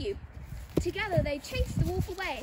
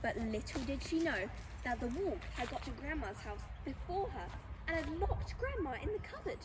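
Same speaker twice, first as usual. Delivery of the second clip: ordinary talk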